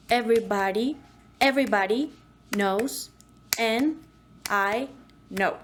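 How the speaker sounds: "everybody, everybody" repeated, then "knows", "and", "I", "know" as separate beats; broken rhythm. Shown as a demonstration. Coach showing a new rhythm for the phrase. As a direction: space each word apart with pauses. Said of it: "change the rhythm as you want".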